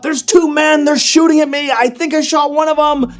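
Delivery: high, urgent acted yell. Ada tells her friend she's under fire, yelling out. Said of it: "yell out".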